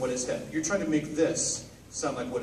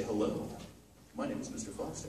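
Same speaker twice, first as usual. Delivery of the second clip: softer and lower, subdued; a quiet, low hello; low quiet version; 5 semitones lower, 10 dB quieter than usual. Shown as a demonstration. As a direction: say it as if holding back. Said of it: "different interpretation".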